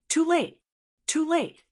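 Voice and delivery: high-pitched triumphant exclamations; crazed gloating. A narrator's reading. Purple-robed old man defying the emperor's warning, crazed gloating. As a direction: crow it high, each time punched out. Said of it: "The purple-robed old man laughed crazily".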